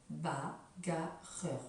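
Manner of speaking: bagarreur drawn out slowly as ba-ga-rreur; syllable by syllable. Shown as a demonstration. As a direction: stretch out bagarreur beat by beat. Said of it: "On fait taper aux enfants les sons en nombre de syllabes".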